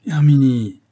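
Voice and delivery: a husband gently scolding; reproachful. Acted. The husband reproaches his wife, calling her name. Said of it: "fit Brun d'un ton de reproche".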